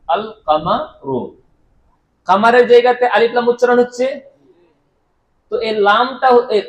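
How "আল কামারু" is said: আল কামারু slow, the L clearly sounded before কামারু; slow model reading. Shown as a demonstration. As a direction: model আল কামারু slowly for the class to repeat. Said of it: "আচ্ছা, বলুন".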